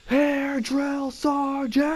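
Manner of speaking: high, stretched-out recruit yell; mock full-lung yell. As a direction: sound off, dragging out the last word. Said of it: "I yell at the top of my lungs".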